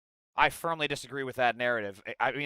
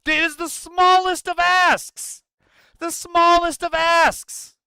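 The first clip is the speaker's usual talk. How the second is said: building to a high, slow shout; drawn-out, high-pitched imploring yell; exasperated plea; 12 semitones higher, 7 dB louder, slower than usual. Retold or imitated. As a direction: plead on behalf of others, louder and higher as it goes. Acted plea building to a cry.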